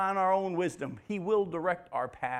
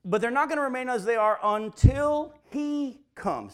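high, loud, with stress on not; emphatic preaching; 9 semitones higher, 7 dB louder than usual. Real, not acted. Preacher driving home the point to his church: high loud emphatic. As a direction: drive it home to the congregation.

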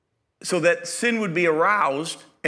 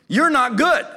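emphatic declaration; 7 semitones higher, 5 dB louder than usual. Real, not acted. Preacher drives home the point.

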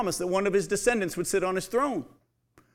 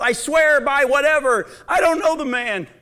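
loud, high panicked oath; desperate denial; 7 semitones higher, 6 dB louder than usual. Acted. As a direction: swear your way out, raised. Peter swears a false oath.

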